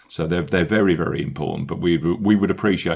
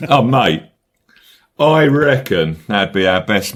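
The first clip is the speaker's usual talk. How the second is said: louder, hammed-up Aussie accent; butchered Australian accent; 5 dB louder than usual. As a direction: play up a badly faked Australian accent. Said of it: "[name] butchering accents".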